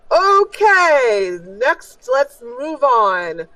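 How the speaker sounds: loud drawn-out "okay", then moving on; showman ringmaster. Acted. Ringmaster to the crowd, calling the next contestant, showman.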